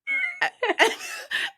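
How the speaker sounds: real high-pitched laugh; high burst of laughter. Real, not acted. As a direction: let a squealing laugh out. Laughing at patient's remark.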